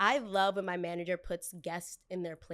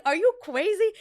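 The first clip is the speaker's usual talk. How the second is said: much higher and louder disbelief; loud high-pitched outrage; indignant outburst; 11 semitones higher, 8 dB louder than usual. Retold or imitated. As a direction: blurt it out in shock, high. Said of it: "smile even harder".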